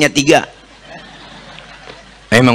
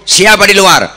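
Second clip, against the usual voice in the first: higher and louder, calling from his bed; sick man calling out; 6 semitones higher, 4 dB louder than usual. Acted. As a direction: call out suspiciously to the door. Sick man answers the door. (orang sakit berteriak dari dalam kamar)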